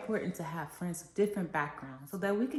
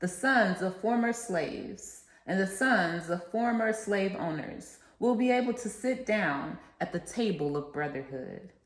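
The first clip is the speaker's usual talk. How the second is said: rousing oratory with a preacher's cadence; formal speech; 5 dB louder than usual. Acted. Student reciting the I Have a Dream speech to listeners, rousing oratory. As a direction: proclaim each line like a civil rights speech to a crowd.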